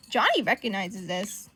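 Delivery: ordinary delivery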